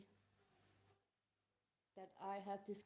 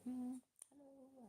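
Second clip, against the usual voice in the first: put-on higher, slow, soft hello to a child; gentle smiling hello; 5 semitones higher, 9 dB quieter, slower than usual. Woman in a restaurant greeting a sad little girl, soft and slow. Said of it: "I tried to smile to her and say".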